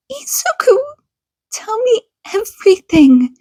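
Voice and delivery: high, pleading but firm; urgent mother. Acted. Mother to her bullied son, urgent plea. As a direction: press him gently to tell all.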